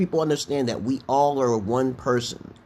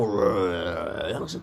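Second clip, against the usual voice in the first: long, drawn-out low monster rumble; imitated creature sound; 6 semitones lower, 3 dB quieter, much slower than usual. Gamer imitating the sound from under the bridge, creature rumble. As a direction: imitate the creature's growl, slowly. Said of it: "I heard the sound".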